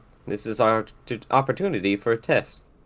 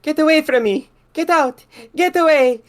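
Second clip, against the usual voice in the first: very high, put-on frightened woman; yelling; 5 dB louder than usual. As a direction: shriek him away in mock terror. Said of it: "Francesca yells".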